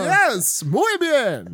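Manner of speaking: put-on high, loud Spanish-accented "muy bien". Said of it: "Yes, throw me the soccer ball".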